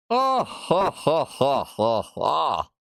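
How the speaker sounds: spoken mock laugh, starting high and sinking; reading out a laugh. Streamer acting out the written laugh, mock villain laugh. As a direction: read the ha-has as a hammy villain laugh. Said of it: "It literally says, laugh like".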